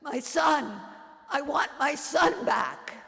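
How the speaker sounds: mother screaming for her dead son; screaming to heaven. Acted. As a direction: cry out with everything left. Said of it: "I scream to the heavens".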